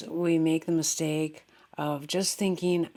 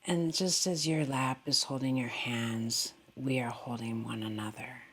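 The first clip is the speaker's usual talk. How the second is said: calm, unhurried meditation voice with long pauses; guided meditation; 2 semitones lower than usual. Real, not acted. Meditation teacher guiding listeners into goodwill and breath, calmly. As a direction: settle gently downward at the ends of phrases.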